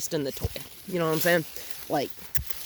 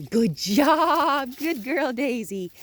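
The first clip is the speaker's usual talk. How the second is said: much higher and louder, slow praise; happy praise; 8 semitones higher, 5 dB louder, much slower than usual. Real, not acted. Trainer praising the puppy for chasing the toy. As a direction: cheer her on.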